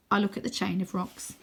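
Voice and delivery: ordinary delivery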